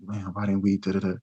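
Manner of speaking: lightly played hushed grumble; hushed sneaking talk. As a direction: murmur it so nobody overhears. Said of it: "people sneaking away, talking like".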